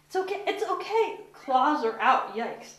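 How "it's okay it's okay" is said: calm the frightened cat up high; soothing the cat. Real, not acted. Owner coos to her cat in the bath, high reassuring.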